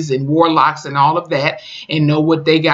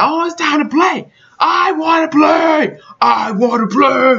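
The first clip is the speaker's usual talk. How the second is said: high, drawn-out mock creature-child cry; 10 semitones higher, 3 dB louder, slower than usual. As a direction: imitate a little monster child wanting to play. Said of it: "that little creature child".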